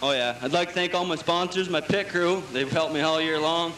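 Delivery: ordinary talk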